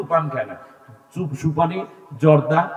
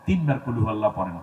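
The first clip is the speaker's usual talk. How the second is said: ordinary talk, nothing marked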